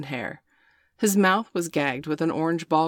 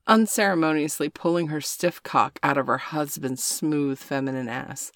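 ordinary delivery

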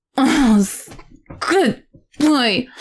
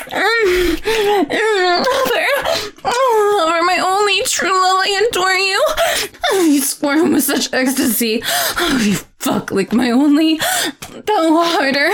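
much higher, breathless with ecstasy; ecstatic moaning; 10 semitones higher than usual. Acted. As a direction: let the ecstasy carry every word up high. Ecstatic climax of the scene.

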